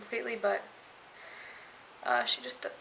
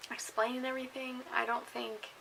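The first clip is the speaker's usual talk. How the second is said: ordinary talk, nothing marked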